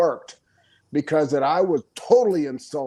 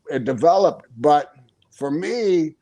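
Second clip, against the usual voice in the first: normal talking voice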